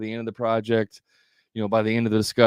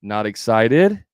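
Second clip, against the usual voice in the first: "excited" slow and louder, swooping upward; overdone questioning lift at the end of "excited"; exaggerated rising inflection; 5 dB louder, slower than usual. Shown as a demonstration. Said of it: "I'm over-exaggerating now".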